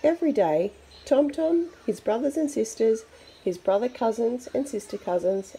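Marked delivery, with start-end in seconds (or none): none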